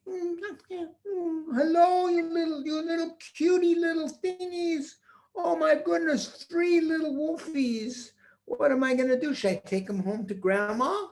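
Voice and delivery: put-on high, sweet baby talk; cooing to puppies. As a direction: coo like over a puppy. Peter cooing at cubs.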